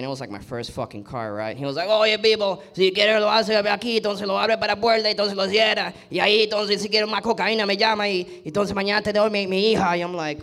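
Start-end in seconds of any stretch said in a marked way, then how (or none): 1.9-9.9 high, loud Cuban Spanish rattle; strong Cuban accent. Retold or imitated. Cuban dad selling a Mustang, strong Cuban accent. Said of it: "they have a strong accent".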